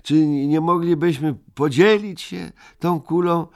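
butter him up to share; wheedling plea. Acted. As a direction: Ryjek coaxing Moomin to share the globe. Ryjek wheedling his friend.

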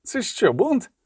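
high, indignant cry of disbelief; shocked outrage at a mutiny; outraged disbelief. A narrator's reading. Daker outraged that his men turn back, high indignant cry.

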